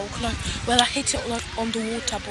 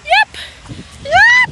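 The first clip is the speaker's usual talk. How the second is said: squeaky high; 13 dB louder than usual. Real, not acted. Voice jumping very high.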